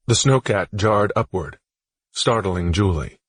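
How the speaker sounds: plain reading voice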